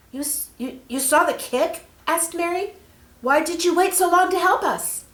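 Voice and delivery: high, loud astonished questions; stammering in amazement; surprised girl. Acted. Mary amazed the angel saw her kick, high and loud.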